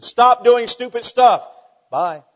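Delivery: deadpan joke sermon; blunt preacher shout. Retold or imitated. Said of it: "He said".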